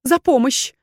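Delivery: high, loud, pleading woman's voice; overwrought, high-pitched justification; desperate confession. A narrator's reading. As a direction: pour out excuses, begging to be believed. Accused woman's frantic confession.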